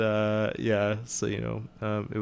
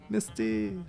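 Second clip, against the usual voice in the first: softer, badly faked French Canadian; bad French Canadian accent; 7 dB quieter than usual. Shown as a demonstration. Showing the co-host a really bad French Canadian accent. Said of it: "my really bad French Canadian accent".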